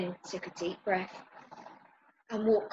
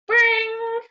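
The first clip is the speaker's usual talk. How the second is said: high, loud imitated doorbell; doorbell ring; 8 dB louder than usual. A narrator's reading. Narrator imitates the doorbell startling Ben, high ring. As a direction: sing out a sudden bell sound.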